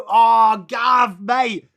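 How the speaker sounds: loud, high call of a name; excited shout. Real, not acted. Streamer hailing chatter Gav, loud excited call. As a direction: call out to him across the room.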